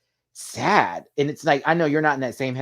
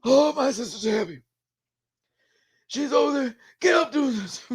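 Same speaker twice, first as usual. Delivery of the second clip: imitating the brother Chris, pitched way up; Chris impression; 4 dB louder than usual. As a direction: slip into Chris's high sing-song voice. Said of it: "I got that voice down".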